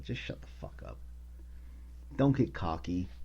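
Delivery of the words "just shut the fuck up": whispered, much softer shut-up; hushed telling-off. Real, not acted. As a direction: hiss it under your breath. Telling off Michael Rappaport.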